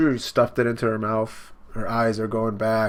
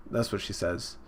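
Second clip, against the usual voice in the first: usual speaking voice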